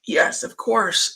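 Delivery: ordinary talk, nothing marked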